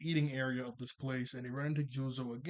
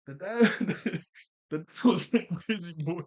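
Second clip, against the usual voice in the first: laughing through the words, pitched up; 4 semitones higher, 8 dB louder than usual. Real, not acted. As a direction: crack up mid-sentence. Cracking up at own remark.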